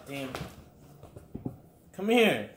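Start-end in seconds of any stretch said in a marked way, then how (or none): 1.9-2.5 high, loud call to the pet. Real, not acted. Driver calling his pet Fluffy over. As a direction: call her over, pitched up.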